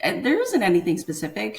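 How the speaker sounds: high, quick, carefree take on her younger self; breezy younger self. Retold or imitated. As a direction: toss it off like a kid who didn't notice anything. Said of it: "I was like".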